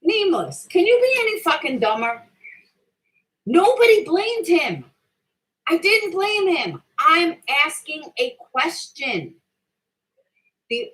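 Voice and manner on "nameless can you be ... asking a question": raised, louder voice, exasperated; angry outburst. Real, not acted. Streamer furious at a commenter, raised angry voice. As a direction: snap back at the chat in real anger. Heated reply to viewer comments.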